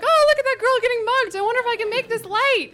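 high, loud, breezy mock driver voice; mocking passerby. A driver cruising past the mugging, high and loud. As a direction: toss it off like a careless passerby. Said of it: "People like".

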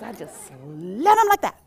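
lightly voiced matter-of-fact lady, slow; a hint of a blunt Utah lady; imitating lady. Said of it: "She goes".

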